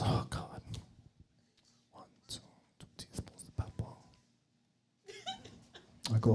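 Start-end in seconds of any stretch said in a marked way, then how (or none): 0.0-3.9 whispered, reluctant repeat; the wife grudgingly whispering the recipe back; sulky whisper. Retold or imitated.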